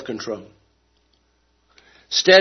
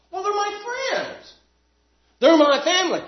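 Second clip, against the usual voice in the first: defensive mock reply. Mimicking a defensive believer.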